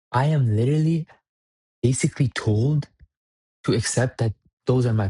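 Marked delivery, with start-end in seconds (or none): none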